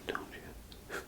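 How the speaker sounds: ordinary talk, nothing marked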